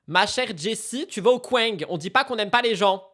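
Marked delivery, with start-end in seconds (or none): none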